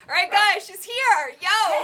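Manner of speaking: excited shout. Real, not acted. Announcing the friend's arrival to viewers, an excited shout.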